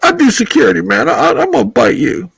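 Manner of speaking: high-pitched put-on character voice; Tucker. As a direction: pitch it way up for a guessing-game impression. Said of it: "That's Tucker".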